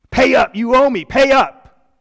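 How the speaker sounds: enraged servant screaming pay up; furious shout. Acted. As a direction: bark the demand while throttling him. Forgiven servant throttles debtor.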